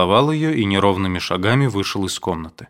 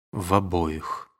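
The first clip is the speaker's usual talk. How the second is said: much softer, slow and gloomy; gloomily; 2 semitones lower, 7 dB quieter, slower than usual. A narrator's reading. Aduev answers the mother gloomily, soft and slow. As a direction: say it darkly, all hope gone. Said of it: "мрачно произнёс Адуев".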